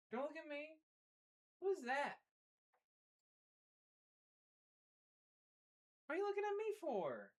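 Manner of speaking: soft cooing dog talk, often high. Real, not acted. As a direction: coo softly at the dog, curious. Talking to the dog.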